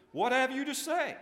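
high, loud, imperious question; thundering demand. A narrator's reading. Prince to his trembling demons, thundering. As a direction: demand an answer from cowering underlings. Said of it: "the prince thundered".

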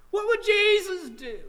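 high, loud challenge; impassioned cry. Real, not acted. Preacher challenging congregation after sad story, crying out. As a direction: fling the question out to the room.

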